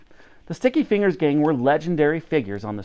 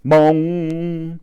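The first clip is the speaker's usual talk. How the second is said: louder, voiced bell toll; clock bong; 3 semitones higher, 4 dB louder than usual. A narrator's reading. Narrator voicing Big Ben striking, a loud booming bong.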